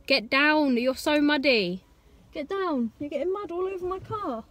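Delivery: high, pleading telling-off; telling the dog off. Real, not acted. Owner to muddy Winnie at the car, high-pitched scolding. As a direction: shoo the muddy dog off gently.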